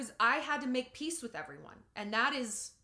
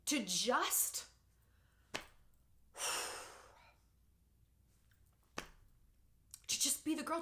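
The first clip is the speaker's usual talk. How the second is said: halting, with long pauses and a heavy sigh; overcome, sighing before going on; choked up; 3 semitones higher, 4 dB quieter than usual. Real, not acted. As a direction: stop to gather yourself through tears. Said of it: "this statement is affecting me".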